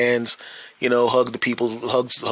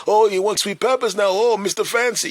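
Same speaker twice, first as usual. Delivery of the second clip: high, loud mock teasing; teasing shop owner; 10 semitones higher, 4 dB louder than usual. Owner teasing customer about sweet peppers, high mock jab. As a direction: rib him playfully.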